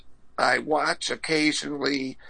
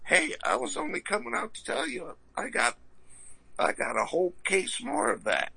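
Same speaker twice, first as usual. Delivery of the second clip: high, fast, lisping boxer voice; squeaky champ, words tumbling out; Mike Tyson impression; 10 semitones higher, 3 dB quieter than usual. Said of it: "And Mike Tyson said".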